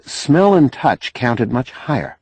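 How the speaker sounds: usual speaking voice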